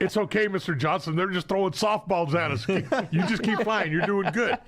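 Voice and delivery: high-pitched, chuckling reenactment of cheerful reassurance; laughing reassurance. Retold or imitated. Gunner reassuring his scared pilot under fire, laughing, high-pitched. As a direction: imitate yourself laughing through the danger, voice pitched well up. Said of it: "all I can hear you is laughing".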